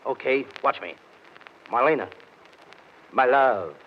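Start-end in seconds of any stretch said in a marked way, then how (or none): 1.7-3.7 low, slow mock-romantic wooing; hammed-up suave sweet talk; turning on charm. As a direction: croon it like a smooth lover. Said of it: "I'll turn on the charm".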